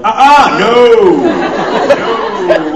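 high, loud, scandalized woman; big comic yelp of refusal; mock outraged refusal. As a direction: imitate a woman shouting in disbelief. Imitating the astonished concierge woman.

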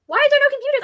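loud, high frustrated yell; frustrated shout. Real, not acted. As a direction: yell it out, annoyed. Frustrated search in game.

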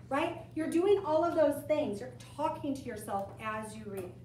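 ordinary talk

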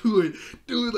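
high, loud imitation of delighted cheering; gleeful egging-on. Glimmer cheering on a fight with Adora, gleefully. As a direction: egg them on, bursting with mock glee. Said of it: "she's so happy to see this happen".

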